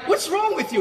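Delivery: high, sharp mock question of old friends; mock scolding others. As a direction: imitate puzzled friends judging him. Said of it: "They're like".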